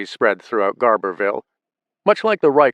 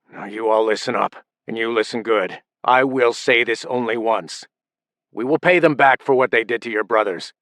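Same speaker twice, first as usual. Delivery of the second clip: menacing anger; 2 semitones higher than usual. A narrator's reading. Furious father threatening sons.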